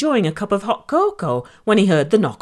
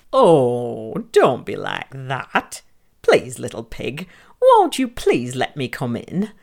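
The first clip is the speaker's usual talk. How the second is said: coaxing wolf; 6 semitones lower, slower than usual. Acted. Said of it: "the wolf tried again".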